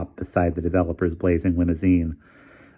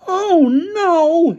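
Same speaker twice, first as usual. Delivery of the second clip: very high, louder cry; appalled cry; 8 dB louder than usual. A narrator's reading. Featherstone crying out appalled at the girls rushing in. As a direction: cry out in dismay. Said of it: "shouted Featherstone, appalled".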